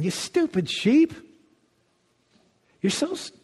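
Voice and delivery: lightly voiced angry shepherd scolding the lost sheep; mock scolding. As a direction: play a harsh shepherd berating the sheep.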